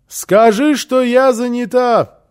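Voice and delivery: high, loud yell back; shouted reply. A narrator's reading. Housekeeper answers from behind closed door, shouting. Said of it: "крикнула в ответ Фрёкинбок из-за закрытой двери".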